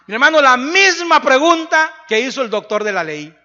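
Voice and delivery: loud, climbing sermon build to a shout; fiery Spanish revival preaching; full-force preaching. Acted.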